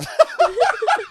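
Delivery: helpless high-pitched cackle; loud high laugh. Real, not acted. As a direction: burst out laughing loudly. Laughing as he retells.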